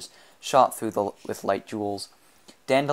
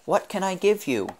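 high-pitched coaxing; wheedle, pitched well up; ingratiating high plea; wheedling; 10 semitones higher than usual. A narrator's reading. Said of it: "took on a wheedling tone".

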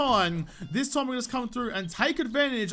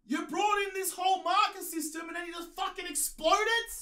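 higher, outraged shout at the game; angry rant at the game; 8 semitones higher, 5 dB quieter than usual. Real, not acted. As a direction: yell at the game in disbelief. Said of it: "I'm angry, I'm mad".

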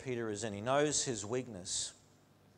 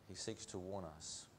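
quiet; 7 semitones lower, 14 dB quieter than usual. Acted. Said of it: "And he seeks to warn us".

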